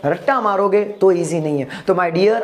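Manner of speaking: usual speaking voice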